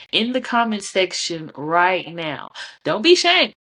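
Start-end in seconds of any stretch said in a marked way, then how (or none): 2.8-3.5 playful high call. Real, not acted. Urging viewers to comment.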